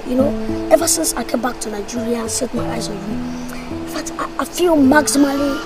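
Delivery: soft, low, hesitant confession; tender wooing. Acted. Suitor asking brother's girlfriend to be his babe, soft and tender. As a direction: murmur it gently to win them over.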